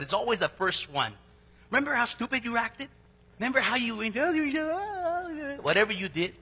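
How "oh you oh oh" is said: put-on high, slow doting coo at a baby; grandparent cooing. Grandparent cooing over the first grandchild, high and slow.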